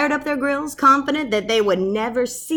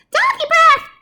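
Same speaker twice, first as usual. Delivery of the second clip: high, loud squeaky scream; panicked mouse scream; screaming mice; 10 dB louder than usual. Acted. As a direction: shriek it in panic. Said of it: "screamed the soldiers".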